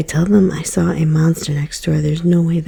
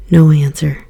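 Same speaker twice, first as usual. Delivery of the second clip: usual speaking voice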